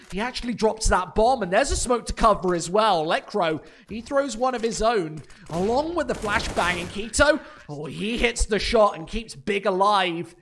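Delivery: loud, fast play-by-play hype; esports caster. Real, not acted. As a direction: call the clutch retake at full energy. Live casting of a retake.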